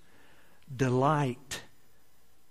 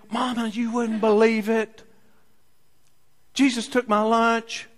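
put-on excited child; 7 semitones higher, 4 dB louder than usual. Boy telling mom excitedly.

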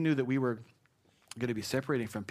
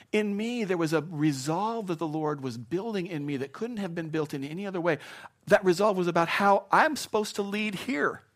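impassioned preaching; 6 semitones higher than usual. Acted. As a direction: preach it out with rising fire. Preaching builds to peak.

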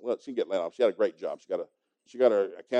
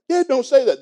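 high, loud protest; protesting daughter; 13 semitones higher, 11 dB louder than usual. Retold or imitated. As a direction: beg him to stop.

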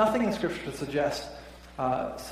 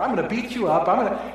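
mock threat; 6 semitones higher, 7 dB louder, faster than usual. Guy on cliff threatens preacher.

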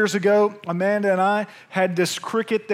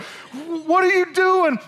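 high, slow alarmed wife voice; startled wife; 9 semitones higher, much slower than usual. Retold or imitated. Pastor imitates his wife waking to see him with a hammer, high and alarmed. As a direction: drag out the words like a startled wife. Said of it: "she freaks out".

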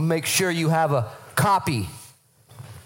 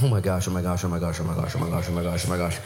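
low, repetitive murmured chant; mock dazed babbling, pitched way down; stunned disciples; 10 semitones lower than usual. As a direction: repeat it on one low note without a break. Stunned disciples coming down the mountain.

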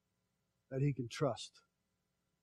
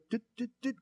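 light higher mimicked dits; road lines noise; 7 semitones higher, faster than usual. Preacher mimicking road lines flashing by, higher ticks. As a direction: tick off passing road lines.

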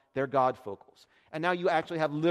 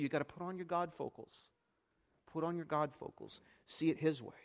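much softer, intimate appeal; quiet urging; 9 dB quieter than usual. Real, not acted. Preacher quietly urging the church to see differently. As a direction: lean in and press it softly.

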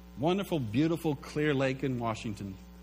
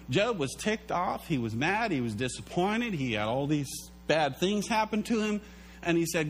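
ordinary talk

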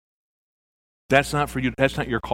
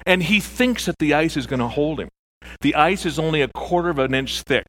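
ordinary talk, nothing marked

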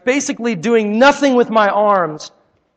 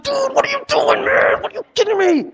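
mock outraged friend, pitched far higher; hammed-up disbelief at being spat on; imitating a friend's high, shocked outrage; exasperated friend; 14 semitones higher than usual. Friend furious after spit.

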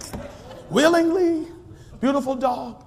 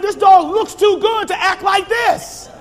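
exasperated owner; 9 semitones higher, 3 dB louder than usual. Retold or imitated. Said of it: "And I'm saying".

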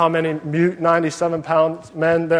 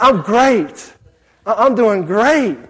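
higher, louder swooping exuberance; overjoyed seatmate; 6 semitones higher, 6 dB louder, slower than usual. Retold or imitated. Huge seatmate answering the reluctant passenger, wildly enthusiastic. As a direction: gush it out in hammed-up delight.

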